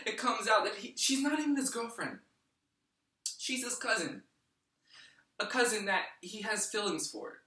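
high, loud outraged disbelief; incredulous reveal. Acted. Man revealing she's not even his girlfriend, loudly incredulous.

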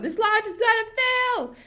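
very high-pitched panicked wail; hammed-up cry of doom; mock meltdown. Coach voices someone stressed before going live, mock meltdown. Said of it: "you're on the brink of a meltdown".

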